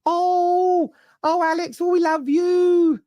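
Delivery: very high, drawn-out mock adoration; fickle Newcastle fan. Newcastle fans forgiving their striker, high-pitched gushing. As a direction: swoon in a silly falsetto. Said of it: "that's why I'm speaking like that".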